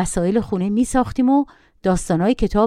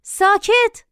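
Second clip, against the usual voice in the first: higher, curt ساکت; sharp rebuke; 11 semitones higher, much slower than usual. A narrator's reading. Narrator scolds the boy with one sharp word. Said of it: "باز تشر زدم".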